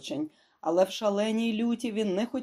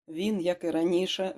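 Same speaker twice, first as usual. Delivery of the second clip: ordinary delivery, nothing marked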